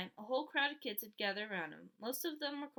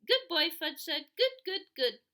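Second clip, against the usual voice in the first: put-on high, loud little-kid chant; high toddler babble, loud; 6 semitones higher, 7 dB louder than usual. A narrator's reading. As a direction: say it like a proud toddler. Toddler repeats words.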